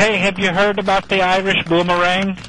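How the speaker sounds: broad comic Irish brogue; put-on Irish accent. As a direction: play a jokey stage Irishman with a brogue.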